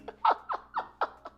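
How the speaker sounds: breathless high squeal of a laugh. Real, not acted. Vlogger laughing at her Jaffa Cake binge, high breathless squeal.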